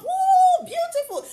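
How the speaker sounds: high, loud whoop of delight, then grateful thanks; delighted squeal. Retold or imitated. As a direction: jump with joy at the present. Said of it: "Mary will jump".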